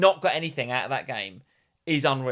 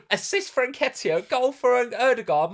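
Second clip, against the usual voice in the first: excited; 9 semitones higher, 5 dB louder, slower than usual. Retold or imitated. Imagining his points excitedly.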